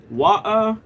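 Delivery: slow "water" with t and r dropped; British pronunciation. Shown as a demonstration. Streamer showing how UK viewers say it, British "water". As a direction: draw out "water" with a glottal stop for the t. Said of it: "you guys say".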